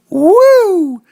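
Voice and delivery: high, loud cheering whoop; excited whoop. Real, not acted. Cheering a good score.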